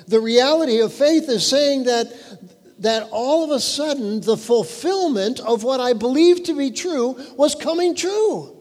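rising preaching fervor. Acted. Preaching builds louder.

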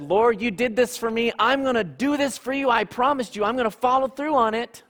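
eager vow to the Lord, high and quick; eager promise. Retold or imitated. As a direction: promise it all in a rush of zeal. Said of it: "Maybe it's a time where you said".

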